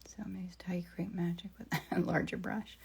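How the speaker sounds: soft whisper to herself. Real, not acted. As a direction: whisper as if painting alone. Whispered painting narration.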